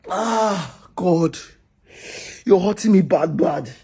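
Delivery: crying. Acted. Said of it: "now that you are crying".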